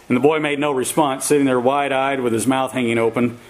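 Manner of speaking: usual speaking voice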